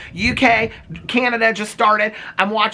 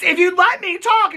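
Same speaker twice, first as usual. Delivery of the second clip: high, exasperated imitation; mock protest pitched way up; Kyle; 12 semitones higher than usual. Housewife trying to get a word in, high mock protest. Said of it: "And then Kyle goes".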